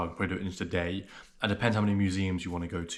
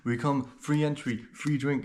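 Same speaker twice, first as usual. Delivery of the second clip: higher-pitched, badly faked Polish accent; attempted Polish accent; 5 semitones higher, slower than usual. Polish tout offering free club entry, mock Polish accent. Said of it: "I can't do a Polish accent".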